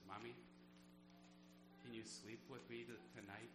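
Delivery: lightly voiced frightened son asking his mother; shaky child's voice. Son at bedtime during a storm, mildly shaky and soft. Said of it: "in a shaky voice".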